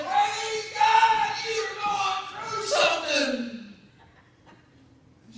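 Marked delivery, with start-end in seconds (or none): 0.0-3.4 high, loud shout, drawn out slow; excited praise. Retold or imitated. Pastor rejoicing over Brenda's trouble, loud slow shout. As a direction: holler it out, stretching each word. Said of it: "I got as excited as she did".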